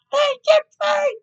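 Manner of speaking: very high screamed outrage; shrieking mock outrage. Podcaster shrieks about McDonald's overcharge, loud. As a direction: scream it like betrayed.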